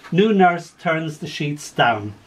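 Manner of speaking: lively story poem read aloud. Acted. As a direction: tell it with gentle humour. Reads a poem with voiced figures.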